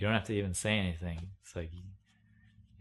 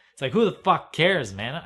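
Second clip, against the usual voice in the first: much higher and louder, fed up; exasperated outburst; 8 semitones higher, 11 dB louder than usual. Real, not acted. Ranting to camera about weather talk, exasperated shout. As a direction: blow up at the whole idea.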